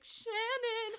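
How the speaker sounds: high-pitched imitation of a thirteen-year-old; mock innocent girlish call; innocent young girl. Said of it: "innocent and, like, thirteen".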